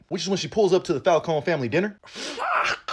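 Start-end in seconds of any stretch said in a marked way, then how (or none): 2.1-2.9 very high, drawn-out rising fuck; mock dramatic curse. Vlogger reacting as Sophia arrives at dinner, squeaky mock dread. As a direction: squeal it like doom is coming.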